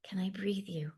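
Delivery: ordinary talk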